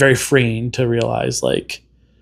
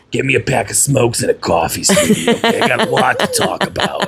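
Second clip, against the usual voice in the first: put-on old smoker lady. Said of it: "this kind of voice going".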